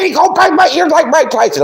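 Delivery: high shouted whine; mocking Rex shout. Rex shouting back on the panel, high mocking shout. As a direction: yell it up high like a panicked whiner. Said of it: "he said".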